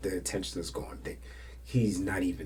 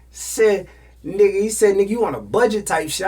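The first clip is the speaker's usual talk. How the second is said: loud outraged retelling; 12 semitones higher, 11 dB louder than usual. Real, not acted. The story's climax.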